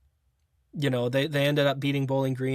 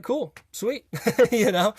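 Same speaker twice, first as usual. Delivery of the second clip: higher, delighted, laughing it off; excited laugh; 9 semitones higher, 4 dB louder than usual. Retold or imitated. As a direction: bubble over with glee. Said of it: "so I'm like".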